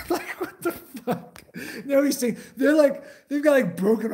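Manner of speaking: high laughing disbelief. Real, not acted. Laughing at soldiers fussing over chem lights.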